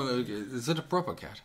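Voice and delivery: a lightly British John Cleese line; light British put-on; British accent. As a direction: try a posh British lilt on proper. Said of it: "you have to do more".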